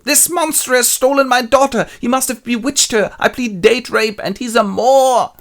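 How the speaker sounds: high, loud, hammed-up fury; outraged accusation. Enraged father accuses Othello.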